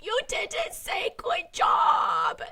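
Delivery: put-on high, slow, whimpering little boy; mock sobbing toddler complaint; imitating a toddler crying. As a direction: mom imitating her two-year-old's meltdown. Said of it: "And he was like".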